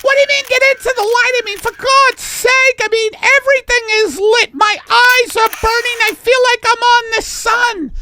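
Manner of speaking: very high, loud, rapid shrieking; hammed-up squealing complaint; exasperated model Millie. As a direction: shriek in frantic falsetto protest. Millie protests in the skit.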